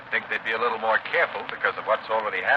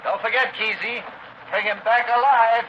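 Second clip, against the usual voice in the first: high, raised voice, stretched on the last words; jeering call; 10 semitones higher, 5 dB louder, slower than usual. Acted. Prison officer calling after the departing guard, loud grim joke.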